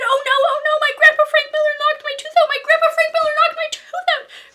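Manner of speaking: very high, very loud frantic yelling; a kid shrieking in alarm as she runs through the house; panicked shout. A narrator's reading. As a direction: scream it like a little girl losing her tooth. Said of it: "I shouted".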